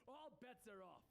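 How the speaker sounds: high, slow proclamation; drawn-out theatrical announcement; Penguin. A narrator's reading. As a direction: call it out as the boss. Penguin declares contest void to crowd.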